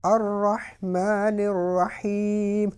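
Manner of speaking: very slow الرحمن الرحیم, meem drawn out; long meem. Shown as a demonstration. Teacher repeating the reciter's verse slowly. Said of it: "اب میم کو پھر انہوں نے لمبا کیا".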